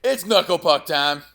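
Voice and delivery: movie quote shout. Retold or imitated.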